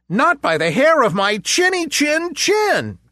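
very high squeaky pig refusal; little pig voice. A narrator's reading. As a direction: squeal it out defiantly.

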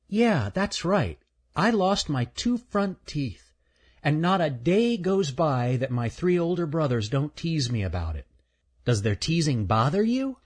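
a curious squirrel greeting a newcomer; friendly little squirrel. Acted. Shelby shows her missing teeth.

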